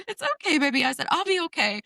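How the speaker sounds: high gentle soothing voice; reassuring mom. Retold or imitated. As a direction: comfort the little one. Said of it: "I was like".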